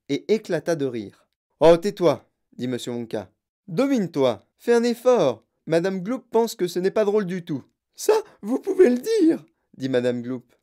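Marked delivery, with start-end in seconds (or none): 1.6-2.3 loud, raised rebuke; scolding. A narrator's reading. Wonka rebukes laughing Oompa Loompa, scolding. 3.7-7.6 higher-pitched exhortation; scolding. A narrator's reading. Wonka tells his helper stop laughing, scolding. 8.0-9.4 very high, loud retort; indignant outburst. A narrator's reading. Mother finds it not funny, indignant.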